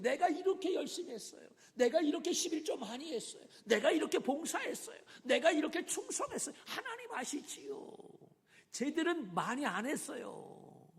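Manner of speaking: a Pharisee listing his good deeds, smug and boastful; boastful prayer. Acted. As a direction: preen before God like a proud Pharisee. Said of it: "자기 자신의 의를 드러냈다".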